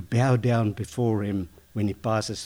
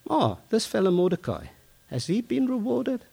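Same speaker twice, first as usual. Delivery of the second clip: surprised king; 11 semitones higher than usual. Retold or imitated. King asks about Mordecai.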